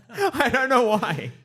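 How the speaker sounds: high, drawn-out words through laughter; helpless amusement at your own reaction; laughing. Real, not acted. Host admitting an odd dislike to friends, laughing.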